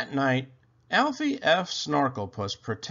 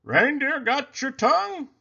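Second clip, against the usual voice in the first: higher, playful Santa voice; teasing Santa; 14 semitones higher, 6 dB louder than usual. A narrator's reading. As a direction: tease him like a jolly grandpa.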